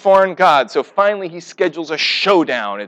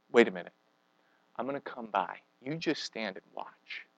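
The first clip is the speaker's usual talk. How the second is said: God hushing the frightened prophet, low and soft; quiet voice of God; 7 semitones lower, 17 dB quieter than usual. Retold or imitated. God calming Elijah on the mountain, low and hushed. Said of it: "And God says".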